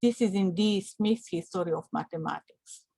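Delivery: ordinary talk, nothing marked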